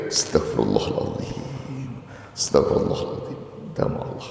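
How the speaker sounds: soft, breathy istighfar; hushed repentant prayer. Shown as a demonstration. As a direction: whisper the plea for forgiveness. Demonstrating humble repentance. (bisikan istighfar penuh tobat)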